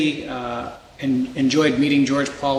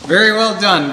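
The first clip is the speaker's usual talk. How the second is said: enthusiastic praise; 5 semitones higher, 6 dB louder than usual. Real, not acted. Praising the drum group.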